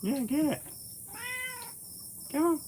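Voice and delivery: squeaky high encouragement to the cat; high pet-talk coaxing. Real, not acted.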